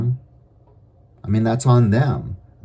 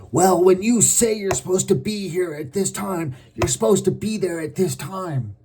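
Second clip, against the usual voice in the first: much higher put-on scolding voice; irate accuser; 10 semitones higher than usual. The angry person scolds the latecomer, much higher. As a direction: lecture like a peeved boss.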